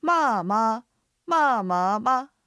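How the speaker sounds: trace the tone contour on まあまあ; tone demonstration. Shown as a demonstration. Teacher checks the accent flow using ma syllables, exaggerated tones. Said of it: "アクセントの流れを確認".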